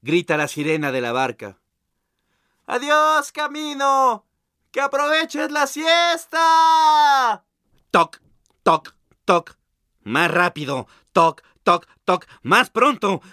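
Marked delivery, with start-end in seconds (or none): 2.7-7.4 voz muy aguda y estirada, gritada; sirena de barca. Acted. 8.0-9.6 imitated engine knock. Ruido del motor imitado. 11.1-12.3 imitated engine knock. Ruido del motor imitado.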